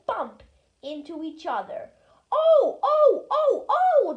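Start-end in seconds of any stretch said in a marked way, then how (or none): 2.3-4.1 high, loud squealing cries; crying trucks. A narrator's reading. Trucks cry out as they bump together. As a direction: squeal in alarm like bumped trucks. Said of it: "they cried again".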